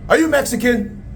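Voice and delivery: pushy high-voiced question; high-pitched questioning. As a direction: press the stranger in a high voice. Interrogating the stranger.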